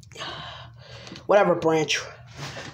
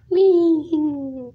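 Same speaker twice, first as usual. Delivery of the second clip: gleeful whee; 8 semitones higher than usual. Acted. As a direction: squeal with delight as you fly. Toy character zooms away.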